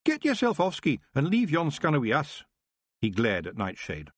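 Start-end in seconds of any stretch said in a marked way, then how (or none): 0.1-2.5 higher, cocky Scottish pixie; brash Scots banter; Scottish Feegle voice. Acted. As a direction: Rob telling Tiffany to go, cocky Scottish accent. Rob's held Feegle voice.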